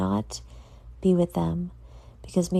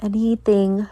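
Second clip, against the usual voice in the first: ordinary delivery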